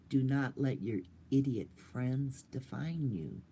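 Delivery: ordinary talk, nothing marked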